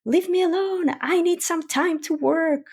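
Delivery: high, impatient brush-off; mock annoyed dismissal; brushing off the kid. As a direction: snap it off like a busy parent. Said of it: "either say".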